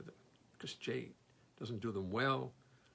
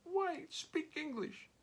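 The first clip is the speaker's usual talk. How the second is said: high, pained French cousin's plea; pained Frenchman; 9 semitones higher, 6 dB quieter, slower than usual. Retold or imitated. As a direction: act out the exasperated French relative, much higher. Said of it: "he looks at me so pained".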